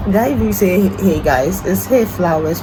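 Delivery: ordinary talk, nothing marked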